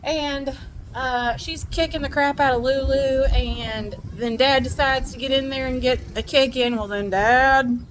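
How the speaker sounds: ordinary talk, nothing marked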